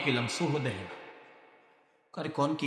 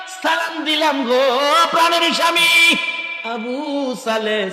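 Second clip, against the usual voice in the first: high, loud, drawn-out cry, easing on the name; called-out greeting; 10 semitones higher, 7 dB louder, slower than usual. Acted. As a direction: belt the salutation high, stretching the name. Bride calls greeting to husband. (চড়া গলায় টানা সালাম)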